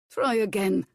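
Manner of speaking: a hint of a gruff foreign cook; bossy kitchen instructions, lightly accented. A narrator's reading. Hercules instructing Violet at potatoes, lightly accented. Cook's order.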